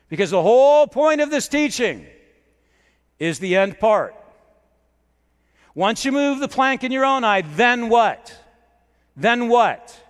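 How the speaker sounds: high, loud preaching with punched key words; forceful preaching. Real, not acted. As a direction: drive the point home to the congregation. Preacher builds to main point.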